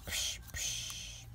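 whispered punch sound effects. Pretend.

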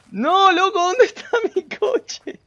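high-pitched lament breaking into laughter; laughing wail. Real, not acted. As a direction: wail in amused despair. Laughing, own car still missing.

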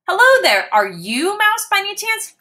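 high, polite little kitten. Acted. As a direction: ask nicely like a small kitten. Max asks politely.

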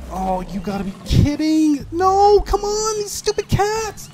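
loud, climbing to a very high shriek; frustrated yelling. Real, not acted. Player attacked by cats, frustrated yelling. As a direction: explode in outraged frustration.

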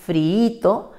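friito split slowly into syllables, fri-i-to. Shown as a demonstration. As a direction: pronounce friito slowly, one syllable at a time. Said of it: "se separaría".

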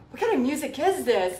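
mildly appalled quote of a guest. Retold or imitated. Painter retelling a guest's complaint about the playlist, appalled. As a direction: imitate an aghast party guest. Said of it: "they were just appalled".

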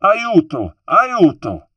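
loud, high repeated cries; high-pitched cry in Italian for help; shout for help. A narrator's reading. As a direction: call out urgently across the water for rescue. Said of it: "she began to shout".

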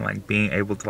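normal talking voice

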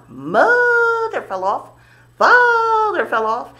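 loud, high, swooping first word; playful falling whoop. Pretend. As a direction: whoop it out, stretching the name. Dramatic falls in a bouncing rhyme.

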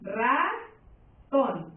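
RA... TÓN, each syllable held with a clear gap; "Ratón" broken in two, RA rising, TÓN falling; split into syllables. Shown as a demonstration. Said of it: "si tú la divides en sílabas".

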